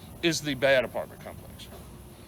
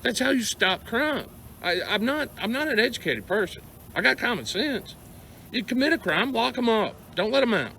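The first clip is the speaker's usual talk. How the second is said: angry rant; 7 semitones higher than usual. Real, not acted. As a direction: vent real frustration, pushing pitch up. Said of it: "Yeah, I'm ranting. The anger side of me is coming back out".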